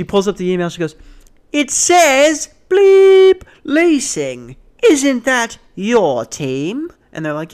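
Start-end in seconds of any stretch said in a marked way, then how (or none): 1.5-6.9 high, loud, slow British lady; wailing outraged British accent. Retold or imitated. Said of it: "the British voice".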